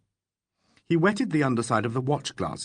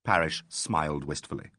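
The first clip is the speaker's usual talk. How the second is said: usual speaking voice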